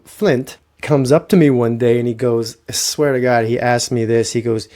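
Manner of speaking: normal talking voice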